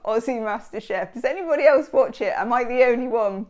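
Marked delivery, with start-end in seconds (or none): none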